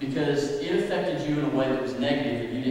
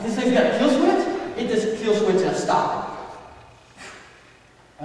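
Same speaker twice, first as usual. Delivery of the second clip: mock disbelief; 5 semitones higher than usual. Boy amazed mid-crash.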